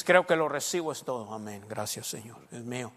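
hushed, low faith declaration; soft affirming prayer; quiet confession. Shown as a demonstration. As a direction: murmur it with calm certainty. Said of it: "Y dices".

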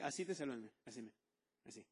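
usual speaking voice

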